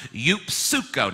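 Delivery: "Eupsuko" drawn out, yoop-SOO-koh; slow Greek pronunciation. Shown as a demonstration. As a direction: slowly sound out "Eupsuko" with the P and S both heard. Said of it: "I'm gonna say it again".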